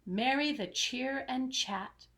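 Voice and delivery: ordinary delivery, nothing marked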